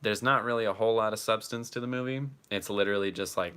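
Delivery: usual speaking voice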